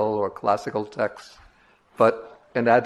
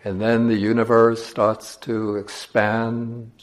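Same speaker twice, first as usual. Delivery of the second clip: slow, measured meditation voice with long pauses; guided meditation; slower than usual. Acted. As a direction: guide listeners slowly, leaving space between phrases.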